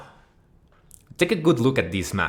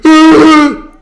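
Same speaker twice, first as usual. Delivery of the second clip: very high, very loud strangled shriek; 19 dB louder than usual. Acted. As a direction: squeal it as if mid-stroke. Mimicking a stroke victim's sound.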